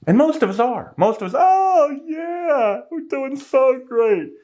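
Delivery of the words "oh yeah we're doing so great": high, slow mocking voice of a naive crowd; sarcastic high sing-song of people who believe the numbers; mocking gullible people. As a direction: play the clueless optimist, drawn out and much higher. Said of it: "they think we're idiots".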